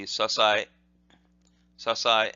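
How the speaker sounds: sasai run together as one short word; sasai said quickly. Shown as a demonstration. Teacher showing the quick form of the term, sasai joined up. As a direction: join the syllables of sasai quickly. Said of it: "or quickly that would be".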